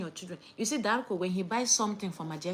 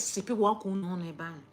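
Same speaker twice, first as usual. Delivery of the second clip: ordinary talk, nothing marked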